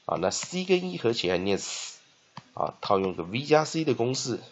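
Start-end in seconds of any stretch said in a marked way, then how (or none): none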